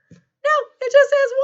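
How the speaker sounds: very high, loud put-on voice; exaggerated high-pitched exclamation; playful high reveal. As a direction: squeal the answer playfully. Mock reveal of the answer.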